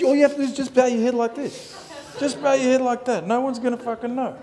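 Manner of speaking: high, amused hippie voice; laughing. Retold or imitated.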